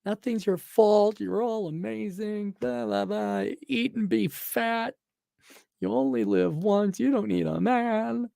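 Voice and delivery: high, slow, soothing mock talk-show host; mock Oprah. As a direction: coo it like Oprah telling women they're perfect.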